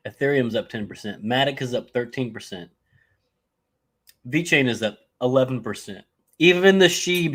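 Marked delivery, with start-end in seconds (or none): 6.4-7.3 excited. Real, not acted. Hyping coin to chat.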